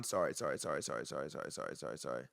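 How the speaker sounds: low, fading string of sorries; muttered apology. Real, not acted. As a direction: rattle off apologies under your breath, trailing away. Flustered self-correction after naming wrong title.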